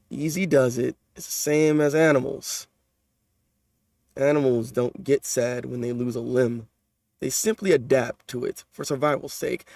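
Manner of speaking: understated calm lecturing voice; measured low soft delivery of a detached figure; calm manga character. Acted. Reads calm character lines.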